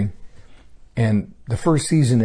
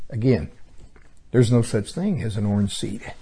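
ordinary talk, nothing marked

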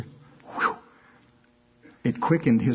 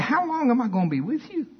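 high-pitched exasperated plea; worn-out teacher losing patience; 11 semitones higher than usual. Retold or imitated. Jesus chiding the disciples, exasperated. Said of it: "how he said it".

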